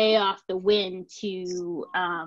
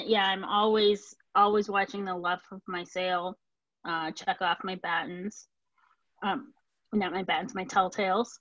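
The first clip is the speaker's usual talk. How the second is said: normal talking voice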